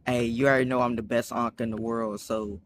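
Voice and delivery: ordinary talk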